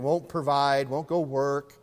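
usual speaking voice

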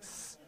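quiet disapproving hiss; mock hiss. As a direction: hiss at the preacher in jest. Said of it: "You're just like".

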